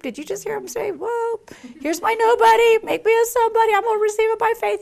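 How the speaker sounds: high, fast delighted voice; hammed-up high excited voice; excited mock God. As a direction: play God gushing with delight.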